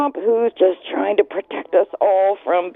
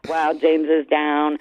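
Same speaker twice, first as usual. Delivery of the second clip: downcast remark; 7 semitones lower, slower than usual. Retold or imitated. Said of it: "when I'm just like".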